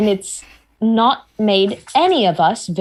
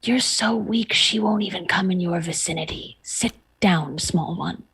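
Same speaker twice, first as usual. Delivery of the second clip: softer condescending taunt down close; taunting murmur; 6 dB quieter than usual. Acted. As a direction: lean in and sneer quietly at the kid. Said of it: "lean down next to... and say".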